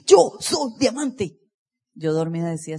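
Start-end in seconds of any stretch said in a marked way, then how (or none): 0.1-1.4 higher, louder, slow proclaimed shout; shouted affirmation. Retold or imitated. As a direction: bellow it out like a morning mantra. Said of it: "a gritar literalmente en el baño". (grito lento y fuerte de afirmación)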